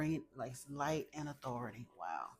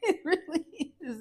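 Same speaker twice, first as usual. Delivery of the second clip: much higher, louder, laughing through tears; teary laugh; 13 semitones higher, 6 dB louder than usual. Real, not acted. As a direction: giggle it out, overwhelmed with joy.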